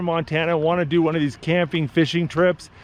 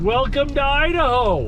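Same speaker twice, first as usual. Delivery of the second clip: excited; 7 semitones higher than usual. Real, not acted. Announcing arrival.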